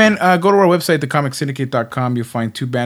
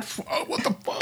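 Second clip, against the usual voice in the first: panicked whisper; 10 dB quieter, faster than usual. Pretend. Imagining the bear waking.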